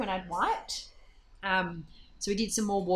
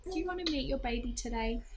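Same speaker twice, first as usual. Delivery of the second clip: softly, a touch higher, soothing; gentle; 6 semitones higher, 6 dB quieter than usual. Retold or imitated. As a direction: coax her gently toward the next step. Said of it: "she said to me very gently".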